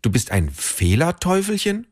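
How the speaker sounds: put-on girl's surprised question. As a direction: echo the word back amazed. Tina asks the devil.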